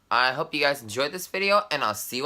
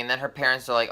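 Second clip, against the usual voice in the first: ordinary talk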